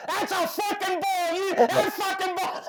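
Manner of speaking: very high, strained scream impression; imitated screaming. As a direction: mimic a catcher screaming at the umpire. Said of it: "he's screaming at me".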